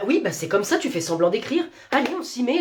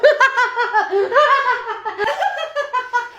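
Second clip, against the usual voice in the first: high, loud mocking burst of laughter; 11 semitones higher, 4 dB louder than usual. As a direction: cackle at her. Mocking colleague's lateness.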